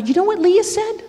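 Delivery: teasing excitement. Retold or imitated. Mother teasing son.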